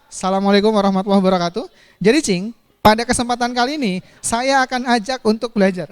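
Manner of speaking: quick, loud formal opening; mock speech to cat. Shown as a demonstration. Teacher demoing a formal speech to a pet cat, brisk and loud. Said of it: "misalkan ibu punya kucing".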